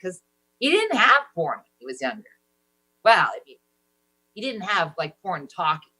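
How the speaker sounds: ordinary talk, nothing marked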